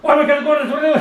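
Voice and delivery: high-pitched mock overexcitement; intense gushing. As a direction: imitate an intense, overexcited fan. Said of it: "this intense, like".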